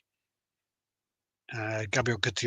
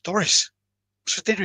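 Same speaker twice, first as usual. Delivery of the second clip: higher, louder call up close; 10 semitones higher, 5 dB louder than usual. Retold or imitated. Husband calls out to his wife from close by. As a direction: call her name firmly.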